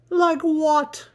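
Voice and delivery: shrill, loud, indignant Kendall; high loud indignant. Acted. Kendall bickering with him, high and indignant. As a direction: fire the question back, offended.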